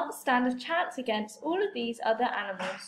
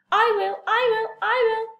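higher and louder, all talking at once; eager clamour; 9 semitones higher, 5 dB louder than usual. Acted. The hungry animals each shouting to eat Toad, eager and loud. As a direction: shout over each other to claim the prize.

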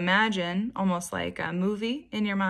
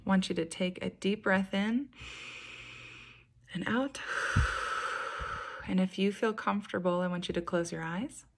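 guided meditation. Acted. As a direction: gently guide them into calm, unhurried and even. Leads a guided beach meditation.

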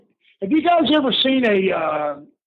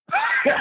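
burst of laughter. Real, not acted. Laughing at his own story.

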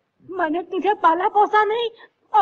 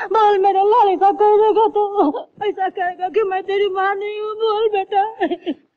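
weeping plea; slower than usual. Acted. Mother imploring her son to deny her, sobbing. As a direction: drag the words out through sobs.

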